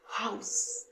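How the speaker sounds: হাউস whispered, all breath; whispered house. Shown as a demonstration. Teacher whispering হাউস to show the air. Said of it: "হাওয়া".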